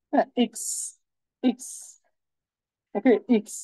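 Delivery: ইক্স said as German X, not এক্স; German letter name. Shown as a demonstration. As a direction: say ইক্স short and crisp. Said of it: "এক্স এর যে উচ্চারণ". (German X-এর নাম ইক্স)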